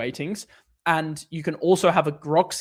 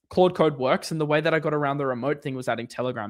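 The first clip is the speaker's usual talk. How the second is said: ordinary delivery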